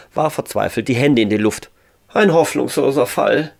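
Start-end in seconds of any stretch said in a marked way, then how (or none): 2.1-3.5 higher start sinking into resignation; resigned. A narrator's reading. Ralf despairs of himself in his armchair, resigned. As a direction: give up on yourself aloud. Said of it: "meinte er resigniert".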